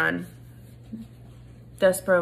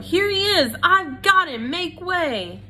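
high, loud proud call; Furlough announcing; 10 semitones higher, 4 dB louder than usual. A narrator's reading. Furlough presents Despero to the crowd, high proud call. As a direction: crow it out to the crowd.

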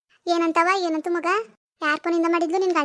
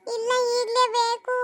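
put-on slow, drawn-out child's whine; tearful insistence; 2 semitones higher, slower than usual. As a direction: insist through tears, stretching the last word. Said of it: "ಅಳ್ಬೇಡಿ". (ಅಳುತ್ತಾ ಹಟ ಹಿಡಿಯುವುದು)

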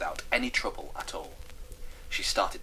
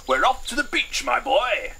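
high, loud, jolly colonel; excited, hearty character voice; 8 dB louder than usual. Acted. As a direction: announce it with colonel's gusto. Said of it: "said Toffington excitedly".

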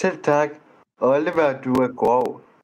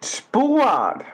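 mocking retort; 10 semitones higher than usual.

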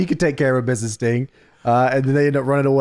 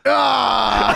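high yelled scream, hammed-up; mock scream; 9 semitones higher than usual. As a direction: let out a comic startled scream. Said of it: "and you hear".

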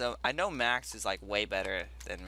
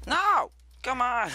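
loud frustrated yell; frustrated shout; 13 semitones higher, 8 dB louder, slower than usual. Real, not acted. Failing a jump in game.

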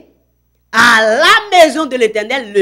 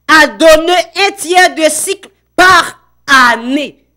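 preaching read; 5 semitones higher, 6 dB louder, slower than usual. Acted. Prédicateur lit le texte biblique.

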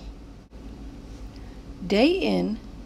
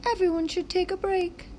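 put-on high childlike character voices; high excited kid voices in a story; 10 semitones higher, faster than usual. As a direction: play the kids and sign kids eagerly. Sign character voice.

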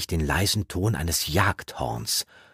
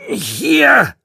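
high, loud irritated shout; angry, raised voice; scolding; 11 dB louder than usual. A narrator's reading. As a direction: call out crossly. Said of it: "schimpfte eine Stimme".

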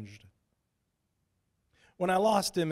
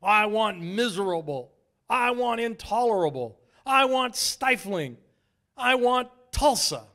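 building, higher and louder with each line; Satan's demand; 7 semitones higher, 6 dB louder than usual. A narrator's reading. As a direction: ramp up Satan's demands until it's a roar. Satan demands more heat.

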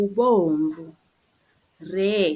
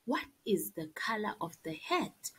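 whispered question; 10 dB quieter than usual. Shown as a demonstration. Teacher asks class.

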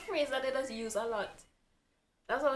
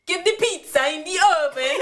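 hammed-up Italian, higher and louder through laughter; Italian accent; 6 semitones higher, 10 dB louder than usual. Friend demands an Italian accent, hammy Italian cook voice. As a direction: bark it like a cartoon Italian chef. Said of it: "Give us an Italian accent".

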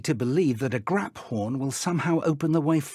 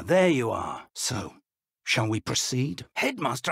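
normal talking voice

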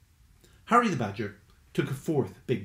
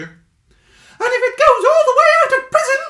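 high, loud squeak with a laugh; bubbling with the discovery; growing excitement; 13 dB louder than usual. Acted. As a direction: Stuart thinking it through aloud. Stuart working it out.

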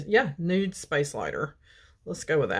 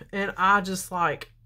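ordinary talk, nothing marked

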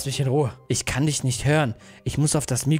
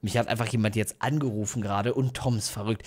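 lower, softer confiding; hushed aside; 5 semitones lower, 5 dB quieter than usual. Real, not acted. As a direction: murmur it to the viewers, unsettled. Leise nach dem Schreck. (leise, gedämpft zum Publikum)

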